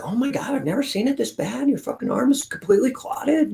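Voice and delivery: high, soft, alarmed gasp. Retold or imitated. Ultrasound tech shocked by the clotted arm, high alarm. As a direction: play the shocked nurse, breathy-high. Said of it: "She's, like, freaking out".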